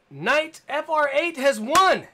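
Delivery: slow, high and loud, drawing out the big news; excited winner announcement. Real, not acted. Host announces the giveaway winner to viewers, excited and loud. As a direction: proclaim the winner like a game show host.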